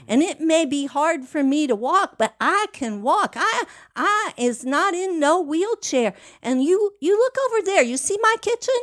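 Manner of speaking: put-on high, rising, insistent older woman; voicing Miss Starr. Miss Starr telling her visitor not to pity her, high and insistent. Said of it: "And she goes".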